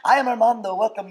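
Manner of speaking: loud, high shopkeeper's welcome; Armando. Retold or imitated. Teller imitates the castmate's shopkeeper act, hammed-up greeting. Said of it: "he'd been doing this role where he's Armando".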